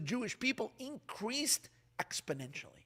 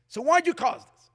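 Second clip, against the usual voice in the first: indignant; 9 semitones higher, 9 dB louder than usual. Retold or imitated. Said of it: "Moshe's question is".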